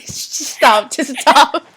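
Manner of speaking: giggling, begging to stop; half-laughing plea to quit; laughing protest. Real, not acted.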